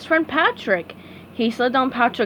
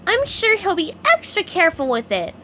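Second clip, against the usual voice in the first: SpongeBob voice; 7 semitones higher than usual. A narrator's reading. SpongeBob reassuring himself.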